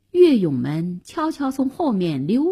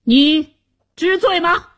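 higher, louder accusing demand; imposing stern shout; 8 semitones higher, 5 dB louder than usual. A narrator's reading. Confronting the chancellor with his crime, imposing shout. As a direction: thunder it out like a judge. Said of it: "威风凛凛地喝道".

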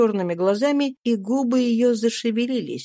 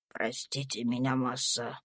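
Tom's meek voice; 9 semitones lower, 12 dB quieter than usual. A narrator's reading. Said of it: "сказал Том".

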